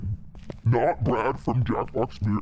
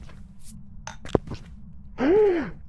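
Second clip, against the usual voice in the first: big indignant gasp; shocked gasp. Real, not acted. Player sees the ghost step in the salt, shocked gasp. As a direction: gasp in outrage. Salt just got stepped in.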